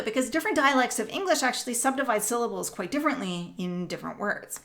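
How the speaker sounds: ordinary delivery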